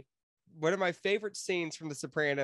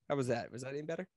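ordinary talk, nothing marked

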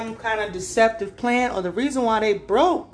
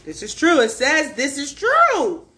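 higher, louder, slow insistence; excited; 5 semitones higher, 6 dB louder than usual. Real, not acted. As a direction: stretch it out, thrilled. Excited card confirmation.

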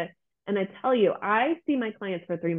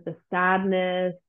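normal talking voice

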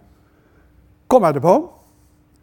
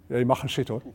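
ordinary delivery

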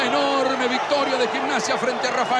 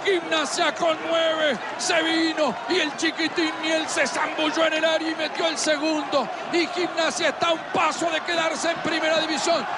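excited play-by-play; 4 semitones higher than usual. Acted. Replayed first goal commentary.

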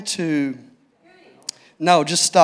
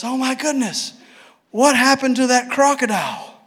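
amazed question; 8 semitones higher than usual. Retold or imitated. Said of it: "she goes".

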